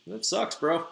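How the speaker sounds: casual, half-hearted mock sympathy; offhand, lightly acted indifference; unbothered shrug. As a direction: shrug it off like it's not your problem. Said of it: "doesn't seem to feel too bad about it".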